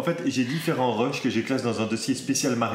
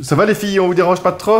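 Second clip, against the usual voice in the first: calling out; 6 semitones higher, 9 dB louder than usual. Real, not acted. Calling out to the girls.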